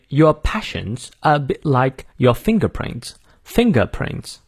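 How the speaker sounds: slow British teaching demo; British accent. Shown as a demonstration. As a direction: read it in British English, R dropped in "Your" and "finger". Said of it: "我们来看一下英式发音".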